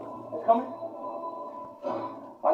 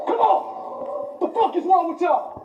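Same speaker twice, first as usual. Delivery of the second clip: panicked shout; 6 semitones higher, slower than usual. Real, not acted.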